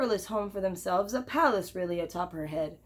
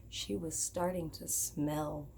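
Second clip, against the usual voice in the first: lower, softer and slow; slow soft confession; hushed reveal; 5 semitones lower, 10 dB quieter, slower than usual. A narrator's reading. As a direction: drop it quietly like an embarrassing secret. Coy pause before blunt reveal.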